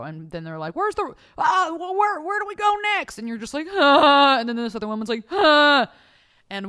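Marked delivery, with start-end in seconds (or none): 0.8-3.2 high, loud mock family voice; oblivious tourist. 3.7-4.4 high, very loud drawn-out moan; mock moan. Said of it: "while you're moaning". 5.3-5.9 high, very loud echoed moan; copycat moan. Retold or imitated. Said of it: "and then she's moaning too".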